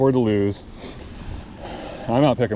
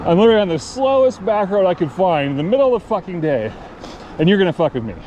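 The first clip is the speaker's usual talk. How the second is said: indignant retort; 5 semitones higher than usual. Retold or imitated. Retelling his argument with driver.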